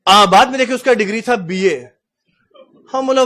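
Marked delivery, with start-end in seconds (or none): none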